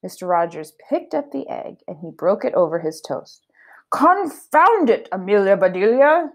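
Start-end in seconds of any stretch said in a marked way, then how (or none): 3.9-6.3 slow, high exasperated outburst; stretched, indignant scold; angry exclamation. Acted. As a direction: draw out Confound it in annoyance. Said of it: "he said".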